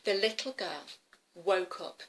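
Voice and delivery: usual speaking voice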